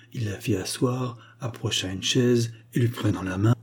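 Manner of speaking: ordinary delivery, nothing marked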